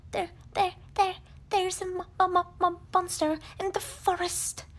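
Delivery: a tiny pig too scared to get the word out; terrified stammer. Acted. Piglet stammers in fright about the monster.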